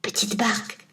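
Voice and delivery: put-on boy whispering to his boat. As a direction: whisper so the witch can't hear. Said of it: "Il chuchote à la barque".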